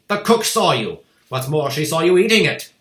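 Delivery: loud, sharp bark. A narrator's reading. Headmistress calling the boy a liar, barking. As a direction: bark the command at him.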